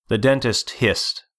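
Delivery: usual speaking voice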